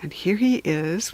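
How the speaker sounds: usual speaking voice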